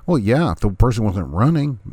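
ordinary talk, nothing marked

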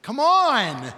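high, loud, drawn-out exasperation; loudly annoyed. Acted. Jesus to his frightened disciples, loudly exasperated. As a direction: stretch the last word in a loud complaint. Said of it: "hear the annoyance in his voice".

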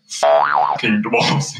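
disgusted elves. Retold or imitated. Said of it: "And they're like".

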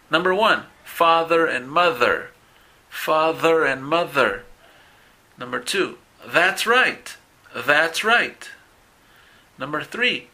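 "father and mother" said slowly with a buzzing voiced th; voiced th phrases. Shown as a demonstration. Teacher drills voiced th phrases, slow and careful. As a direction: voice the th in "Other brother" and "bother". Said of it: "Segunda columna de la imagen tres".

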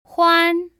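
欢 said high and level, first tone. Shown as a demonstration. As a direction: hold 欢 high and flat, first tone. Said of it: "followed by a first tone".

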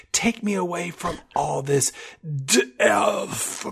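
"death" stretched into many syllables; a slow, soft, overblown "Take me away from all this death". Retold or imitated. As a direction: draw "death" out long and breathy. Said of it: "turns it into a four or five-syllable word".

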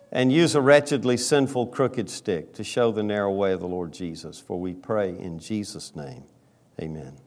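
quiet, low pastoral prayer; hushed prayer voice before the sermon. Acted. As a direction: pray softly and humbly to the Father.

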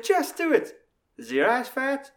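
very high, loud put-on voice; pushy mock voice. Minnie pushing Dave to ask, high loud mock voice. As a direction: push it through in a high comic voice. Voices in retold French joke.

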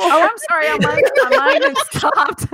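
high giggling confession; laughing. Real, not acted. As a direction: laugh through the apology. Laughing at own mistake.

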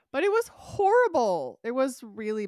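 loud high exasperated exclaim. Real, not acted. Telling friend the episode was awful, loud and exasperated. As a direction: burst out with it.

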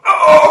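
a sudden high, loud "Oh!" of pain; pained cry. Acted. Game master voicing Bastion struck by a heavy blow, pained cry. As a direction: cry out as if hit by something heavy. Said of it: "you hear Bastion go".

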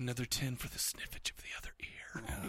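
creepy whisper close to the ear; breathy leering whisper; right in your ear. Pretend. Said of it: "whisper in your ear".

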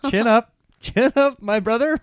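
high, loud pep talk with laughter; jolly the sullen driver along; mock encouragement.

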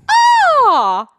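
loud high mock surprise; hammed-up squeal of delight; fake delighted gasp. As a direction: overact joy at the gift. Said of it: "I could have won an academy that day".

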